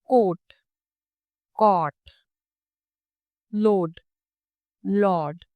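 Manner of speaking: careful contrast of short and long rounded vowels; comparison pairs. Shown as a demonstration. Teacher contrasting vowel pairs for students. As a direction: model each pair slowly and clearly. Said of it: "अगर हम comparison देखें".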